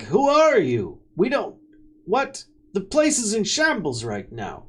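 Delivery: high, rattled guard voice; a guard caught off guard, demanding; flustered guard. Acted. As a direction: guards confused amid the wreckage. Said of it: "and they're like".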